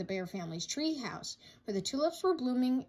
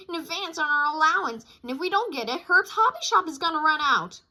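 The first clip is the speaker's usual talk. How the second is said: put-on high, loud urgent child voice; urgent cub plea; 6 semitones higher, 7 dB louder than usual. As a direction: rush the plea like a kid.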